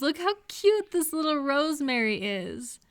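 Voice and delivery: delighted coo over a little rosemary; cooing over a plant. Acted. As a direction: gush over the tiny leaves.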